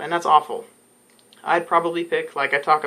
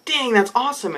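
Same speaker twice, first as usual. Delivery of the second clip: excited; 8 semitones higher than usual. Retold or imitated. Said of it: "I'm like".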